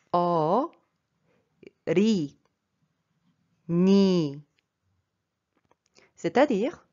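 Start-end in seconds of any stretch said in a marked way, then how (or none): 0.1-4.4 "o, ri, ni." in long, separated syllables; syllable-by-syllable pronunciation. Shown as a demonstration. Teacher shows learners how it is really pronounced, syllable by syllable. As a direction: say "o, ri, ni." slowly, n opening the last syllable. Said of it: "Donc ça se prononce en fait".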